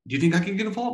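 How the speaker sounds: high, eager question; eager coworker. Retold or imitated. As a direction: ask like he can't wait to join. Coworker asks to join in.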